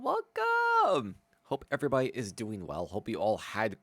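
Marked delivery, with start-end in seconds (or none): 0.0-1.2 high squeaky welcome; high host voice. Acted. Stream host greeting.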